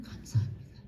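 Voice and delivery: breathless whispered prayer; preacher whispering thanks to God. Real, not acted.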